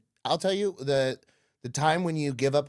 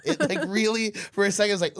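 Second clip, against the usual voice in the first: ordinary delivery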